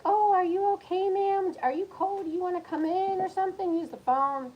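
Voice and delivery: high, sugary helpful voice; overly sweet concern. Retold or imitated. Being nice to a stranger at the door, sugary high. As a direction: play the nice host at the door. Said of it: "trying to be nice, like".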